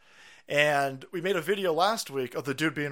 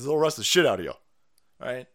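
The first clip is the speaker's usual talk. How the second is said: ordinary talk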